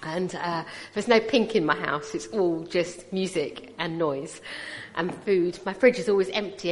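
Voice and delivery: normal talking voice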